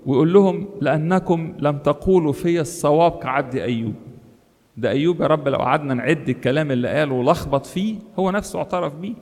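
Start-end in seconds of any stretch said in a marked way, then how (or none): none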